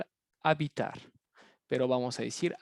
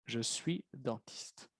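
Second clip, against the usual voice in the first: slow and softer "Je suis dentiste"; slow model answer; 4 semitones lower, 11 dB quieter, slower than usual. Shown as a demonstration. Teacher repeating the answer for students, slow and soft. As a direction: say "Je suis dentiste" slowly, dropping the voice. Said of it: "model answer, quieter and slow".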